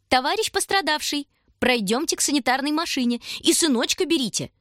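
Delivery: high, held-back giggly formality; trying hard to keep a straight face; mock serious nurse. A narrator's reading. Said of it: "стараясь держаться серьёзно". (притворно серьёзно, сдерживая смех)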